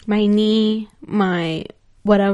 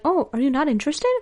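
put-on higher voice of a hopeful guy; imitated rebuffed flirt; 4 semitones higher, faster than usual. Guy asks her after she turns down coffee, mimicked higher. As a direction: mimic a flirt taken aback.

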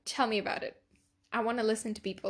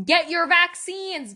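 higher, louder and slower, pleading in earnest; loud urging; 7 semitones higher, 6 dB louder, slower than usual. Real, not acted. Begging listeners to stay safe, loud urgent plea. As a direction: plead hard with "Get" hit up high.

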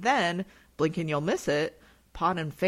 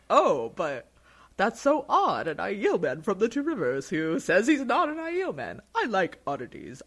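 delighted Ogier; 4 semitones higher, 5 dB louder than usual. Retold or imitated. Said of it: "And Loyal's like".

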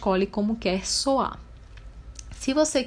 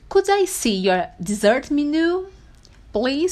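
slow, higher English request, rising please; model phrase; 6 semitones higher, slower than usual. Shown as a demonstration. Teacher modelling asking for desserts, slow and high. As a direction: enunciate politely, lifting the pitch. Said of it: "você pode dizer assim".

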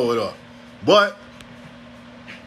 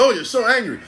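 higher-pitched mock accusation; raised, put-on accusing voice; imitated accusers; 6 semitones higher than usual. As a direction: mimic people pointing out your anger. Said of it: "people see me and they say".

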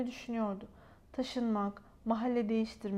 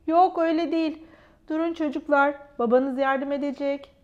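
firm call; 3 semitones higher, 8 dB louder than usual. Acted. Mother stopping the children.

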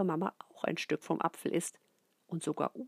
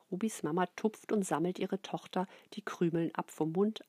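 usual speaking voice

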